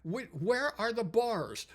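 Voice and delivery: high-pitched, imitated Japanese accent; baffled Japanese engineer. Engineer who took the amps apart, baffled Japanese accent. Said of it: "the Yamaha guy said".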